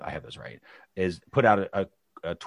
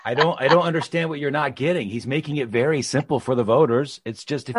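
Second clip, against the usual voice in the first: mock exasperation, loud and higher; sarcastic; 6 semitones higher, 8 dB louder than usual. Son pretending to defend the empty healthcare plan, sarcastic. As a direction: feign bafflement that anyone could miss the point. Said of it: "I'm being quite sarcastic there".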